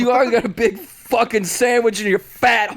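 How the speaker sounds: mock loud street rant; mock Philly rant. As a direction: yell it like a rowdy Philly guy. Mock Philly ranting bit.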